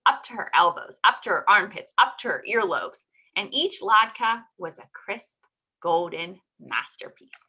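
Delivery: usual speaking voice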